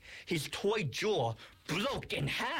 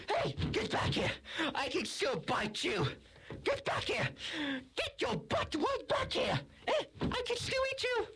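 very high, squawked mock threats; frustrated yelling after someone. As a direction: squawk helpless threats at a foe walking off. Monster yells after departing foe.

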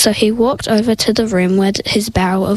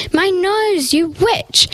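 high-pitched yell at normal volume; narrated yell; 8 semitones higher, slower than usual. A narrator's reading. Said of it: "she yelled".

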